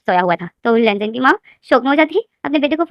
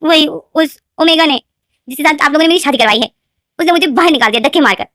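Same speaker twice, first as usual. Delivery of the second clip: complaining son; 5 semitones higher, 5 dB louder than usual. Acted. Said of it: "लेनदेन बोलते हैं".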